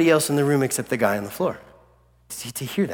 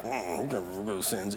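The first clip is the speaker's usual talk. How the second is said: low, very soft mock mutter; grumbling Pharisees; 6 semitones lower, 15 dB quieter than usual. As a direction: grumble under your breath like a Pharisee. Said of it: "I imagine the Pharisees are like".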